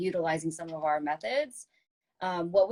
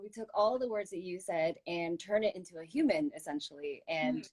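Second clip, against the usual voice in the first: ordinary delivery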